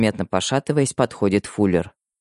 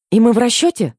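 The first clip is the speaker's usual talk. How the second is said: much higher, louder hasty question; blurted; 11 semitones higher, 5 dB louder than usual. A narrator's reading. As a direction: blurt it before thinking. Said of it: "выпалила я".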